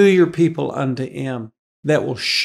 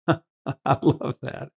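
laughing; 5 dB quieter, much slower than usual. Real, not acted. Preacher laughs at the verse.

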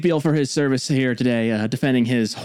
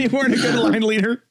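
higher, laughing tease; laughing teasingly; 10 semitones higher than usual. Real, not acted. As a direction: tease him through a laugh.

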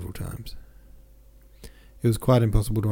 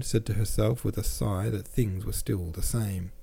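ordinary delivery, nothing marked

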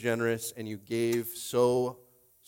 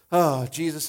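normal talking voice

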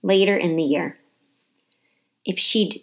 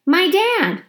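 put-on child's voice calling out high and loud; shouted out; 12 semitones higher, 4 dB louder than usual. A narrator's reading. Boy shouting an answer across the classroom, loud and high. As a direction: blurt it out from the back of the class. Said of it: "A voice shouted out".

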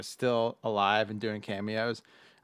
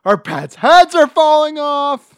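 loud, slow, high mock wail; movie quote yell; 21 dB louder, slower than usual. Quoting the film's despairing line, loud high mock wail. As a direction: wail it out like a desperate fool.

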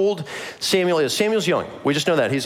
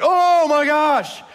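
high exasperated outburst, opening drawn out slowly; frustrated; 12 semitones higher, slower than usual. Acted. Boy hears his name yet again, exasperated outburst. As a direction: wail it in comic exasperation. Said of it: "they're both really frustrated".

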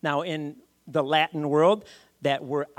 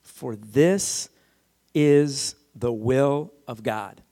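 slow, firm, separated words; clearly and precisely; 2 semitones lower, 4 dB louder, much slower than usual. Retold or imitated. Preacher quoting Paul to the congregation, clearly and precisely. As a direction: set each word down precisely, with pauses. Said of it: "Paul says this clearly and precisely".

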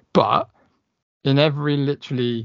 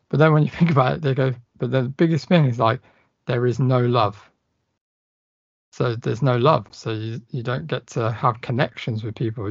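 ordinary talk, nothing marked

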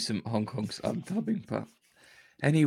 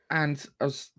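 normal talking voice

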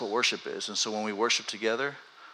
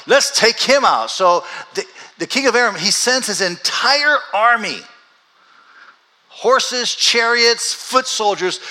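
loud, high, driving preacher voice; preaching at full force; 9 semitones higher, 10 dB louder than usual. Acted. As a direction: proclaim it to the whole room.

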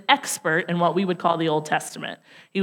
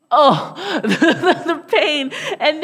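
high and louder, through real laughter; laughing; 9 semitones higher, 3 dB louder, slower than usual. Real, not acted. Preacher recalling the cop's put-down, laughing through it. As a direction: laugh at the embarrassing memory.

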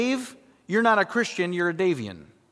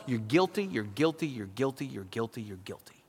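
guilty said lower and softer each time, dying away; the law's verdict receding into silence; fading accusation; 8 semitones lower, 9 dB quieter than usual. Acted. The law telling sinners you're guilty, fading.

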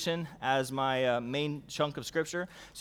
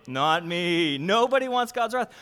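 ordinary talk, nothing marked

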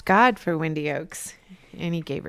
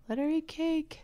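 much higher, soft mock-regal echo; high playful voice; 11 semitones higher, 6 dB quieter than usual. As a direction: toss it off in a silly high voice. Echoes cake quote in funny voice.

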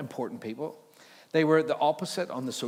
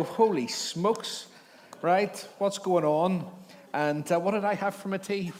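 high, slow, panicky "Holy smokes"; mock terror; 6 semitones higher, 3 dB louder, slower than usual. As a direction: ham up a terrified shepherd. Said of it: "You'd go".

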